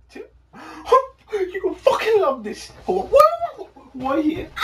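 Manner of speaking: high, excited words through laughter; giddy, pitch climbing into laughter; cracking up. Real, not acted. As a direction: gasp and laugh while trying to get it out. Cracking up over second reason.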